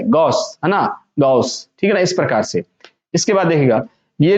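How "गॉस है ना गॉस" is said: "गॉस" as go with a quick hiss added; lower-pitched model of "गॉस"; go plus sh. Shown as a demonstration. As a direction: say go, then quickly close "गॉस" with s-h. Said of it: "गो के बाद में just बोलना है".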